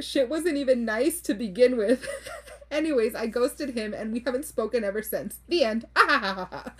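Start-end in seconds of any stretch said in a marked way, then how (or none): 6.0-6.7 deliberate, spelled-out "ah ha ha"; written-out laugh. Acted. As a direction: read a typed laugh aloud, syllable by syllable. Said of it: "I laugh like that because that's literally".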